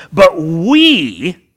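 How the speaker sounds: "but we" with we loud and ringing; stress on we. Shown as a demonstration. Preacher contrasting believers with them, stressing "we". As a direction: hammer "we" home. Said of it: "in contrast to them".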